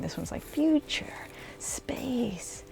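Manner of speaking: excited little whisper. Real, not acted. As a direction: whisper it with a lift. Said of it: "I'm trying to talk a little bit quieter".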